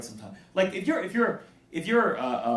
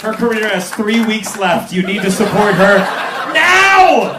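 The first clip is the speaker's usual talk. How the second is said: loud, raised-pitch comic demand; hammed-up drill-sergeant urgency; mock-threatening shout; 8 semitones higher, 12 dB louder than usual. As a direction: bellow orders at the crowd in pretend outrage. Mock bullying crowd into following.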